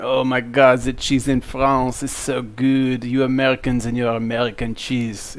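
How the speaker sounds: a loose, hammed-up French accent gushing over cheese; mock French accent, lightly done; bad French accent. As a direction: put on a poor French accent, swooning about cheese. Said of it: "it's really bad French accent".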